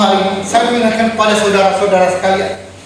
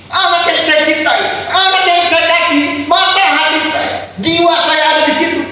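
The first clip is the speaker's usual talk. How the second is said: slow, raised, anguished cry; anguished grief; 5 semitones higher than usual. Real, not acted. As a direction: cry out the loss of his son. Grieving father recalls son's death. (ayah berduka meratapi anaknya dengan suara tinggi)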